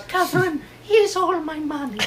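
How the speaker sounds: high, frail-sounding put-on old lady; old dying lady. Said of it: "an old dying lady".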